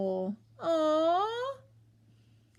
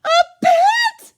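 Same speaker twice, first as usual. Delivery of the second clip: much higher, loud joyful squeal; happy cry; 13 dB louder than usual. Acted. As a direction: burst out gleeful. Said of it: "glucked happily".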